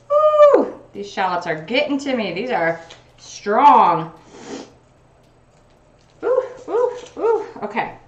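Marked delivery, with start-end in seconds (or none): 0.1-0.8 sudden high woo from stinging eyes; high yelp. Real, not acted. 6.2-7.5 high, pained ooh from burning eyes. Real, not acted. Onion fumes stinging eyes.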